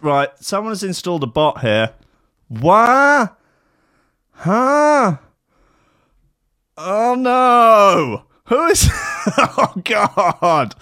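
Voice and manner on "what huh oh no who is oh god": high, loud baffled huh and what; confused shock. Real, not acted. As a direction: react in disbelief again and again. Confused by hacked Discord.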